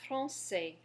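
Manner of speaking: français, ending on an open ai sound; French français. Shown as a demonstration. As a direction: model français. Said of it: "our next example is the word".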